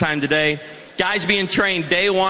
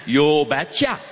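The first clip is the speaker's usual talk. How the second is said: put-on lightly done Norwegian accent, low; a hint of a Norwegian father saying it; 5 semitones lower, slower than usual. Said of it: "in his thick Norwegian accent".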